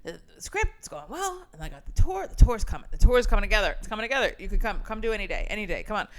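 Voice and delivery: a touch of breathless enthusiasm; imitating excited friend. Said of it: "You'll be like".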